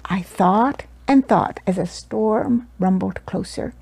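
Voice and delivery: plain reading voice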